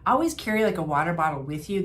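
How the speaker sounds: ordinary delivery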